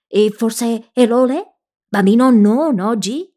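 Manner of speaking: a servant puzzling over the visitors; broken Japanese accent. A narrator's reading. Tiny Japanese servant questions the guests at the door, slow broken accent.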